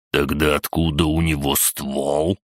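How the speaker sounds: plain reading voice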